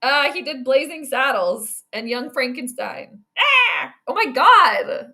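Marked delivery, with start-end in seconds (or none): 3.4-5.1 frustrated outburst. Real, not acted. Can't recall actor's name.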